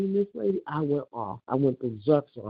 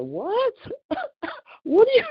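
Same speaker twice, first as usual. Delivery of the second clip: high-pitched, incredulous what, half laughing; replaying the moment she was accused, stunned; shocked disbelief; 7 dB louder than usual. Retelling the phone call to her friends, shocked and much higher. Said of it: "I was shocked".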